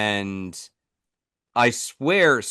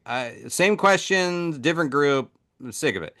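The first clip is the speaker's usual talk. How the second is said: usual speaking voice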